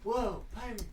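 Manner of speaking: mock an announcer's put-on amazement; forced excitement. Mimicking the trailer narrator's effort, forced excited. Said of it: "he tried so hard to sound excited".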